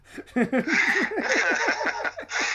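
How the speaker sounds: a loud, high burst of laughter; big laugh. Real, not acted. Laughing at her own Deadpool story, a big laugh. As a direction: laugh hard at the punchline.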